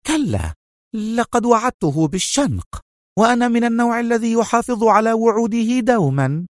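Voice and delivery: high-pitched, tense refusal; edgy. A narrator's reading. Sonia explaining her promised hanging to Rachel, edgily. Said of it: "هزت سونيا رأسها نفيا في عصبية وقالت".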